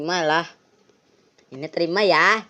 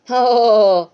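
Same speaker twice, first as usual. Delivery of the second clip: louder sinister Santa chuckle; evil ho ho laugh; 7 semitones higher, 9 dB louder than usual. Acted. (tawa ho ho yang jahat)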